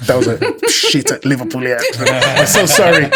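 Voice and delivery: helpless laughter breaking through the words; loud, high, embarrassed laughing; real laugh at your own bad attempt; laughing apology. Real, not acted. Laughs at own failed accent.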